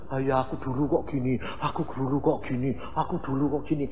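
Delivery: put-on mumbled, fast brooding over old sins; whiny self-reproach. Preacher mimics a convert brooding, muttering fast. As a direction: sigh over the past in a low grumble. Mocking someone dwelling on past.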